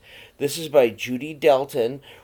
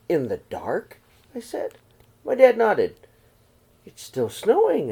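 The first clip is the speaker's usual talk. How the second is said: gentle read-aloud storytelling voice; story narration. A narrator's reading. Teacher reading a snowy night story to the children, in a gentle read-aloud voice. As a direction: read it to the class like a picture book. Reads picture book to class.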